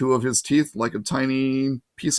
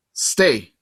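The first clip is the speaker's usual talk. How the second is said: firm command; 5 dB louder than usual. A narrator's reading. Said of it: "Annabeth ordered the monster".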